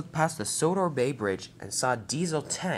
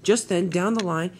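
usual speaking voice

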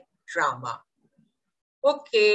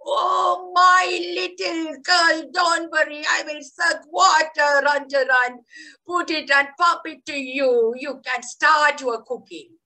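put-on higher, louder call of the old granny roots; 6 semitones higher, 3 dB louder than usual. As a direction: cheerfully shout comfort up to the leaf. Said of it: "the granny roots of the tree said".

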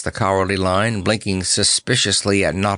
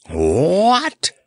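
high, swooping indignant roar; 9 semitones higher, 3 dB louder than usual. A narrator's reading. Lion hearing of his feathers, outraged roar. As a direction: roar it in disbelief, rising in pitch. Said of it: "roared the Cowardly Lion".

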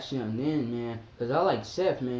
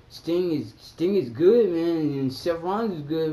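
ordinary delivery

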